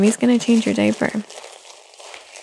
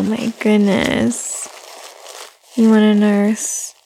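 usual speaking voice

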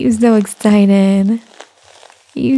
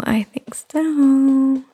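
higher, very slow cooing lilt; higher sing-song; 4 semitones higher, much slower than usual. Acted. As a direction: coo it up high and slow. Soft mommy roleplay throughout.

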